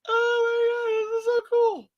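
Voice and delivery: long piercing cry of delight; overjoyed squeal. Real, not acted. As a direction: squeal with giddy awe. Overjoyed at toy figures.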